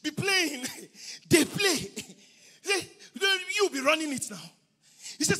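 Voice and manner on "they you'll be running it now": ordinary talk, nothing marked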